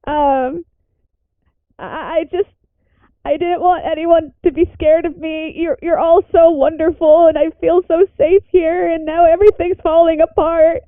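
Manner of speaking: fighting back tears, voice cracking upward; crying in character. Acted. Indy confessing fear to friends, tearful and breaking. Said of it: "Indy just starts crying".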